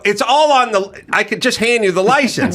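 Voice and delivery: high, pleading, exasperated. Retold or imitated. Voter pleading with a poll volunteer, high exasperation. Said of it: "I go".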